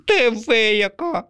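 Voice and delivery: high, slow jittery pigeon; twitchy indignant outburst; nervous pigeon. Acted. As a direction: pigeon jerking his head nervously. Pigeon character voice.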